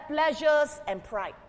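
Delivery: ordinary talk, nothing marked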